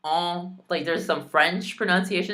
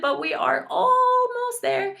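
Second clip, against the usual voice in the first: 1 singsong encouragement; 4 dB louder than usual. Real, not acted. Encouraging viewers.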